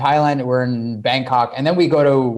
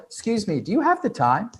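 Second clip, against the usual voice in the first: soft, high, polite query; light and casual, voice lifted; feigned innocent inquiry; 8 semitones higher, 5 dB quieter than usual. Retold or imitated. Man approaching women on a beach, mock innocent.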